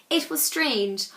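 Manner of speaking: ordinary delivery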